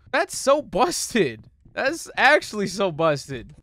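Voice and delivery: slow, high, loud whine; indignant complaint. Real, not acted. Player stuck at the demo, indignant. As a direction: drag it out in exasperation.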